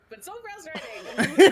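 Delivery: laughing through the words. Real, not acted. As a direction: laugh while saying it. Laughing while complaining.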